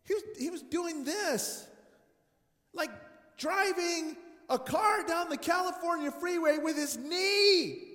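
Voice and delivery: loud, high and amazed; excited shout. Real, not acted. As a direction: shout it out in disbelief.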